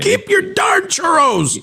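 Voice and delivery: very high, slow, shouted grumble; squeaky grumble. Retold or imitated. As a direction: drag it out in a shrill squeak. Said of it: "same refusal continued".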